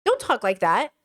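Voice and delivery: ordinary talk, nothing marked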